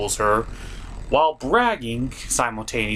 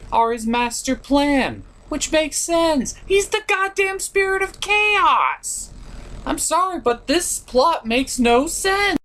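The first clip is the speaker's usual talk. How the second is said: higher, slow, drawn-out disbelief; exasperated rant; 11 semitones higher, slower than usual. Real, not acted. Reviewer ranting that the plot makes no sense, exasperated and higher. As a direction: spell out the obvious, fed up.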